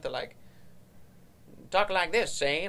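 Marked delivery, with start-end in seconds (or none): 1.7-2.7 higher, louder mock Transatlantic voice; Transatlantic accent. Shown as a demonstration. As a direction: show off a forced old-time Transatlantic accent. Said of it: "That accent".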